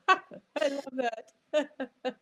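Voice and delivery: high, delighted reply breaking into laughter; real tickled delight, pitched high; delighted laughing. Real, not acted. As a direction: laugh with real pleasure through the words. Delighted reaction to accent compliment.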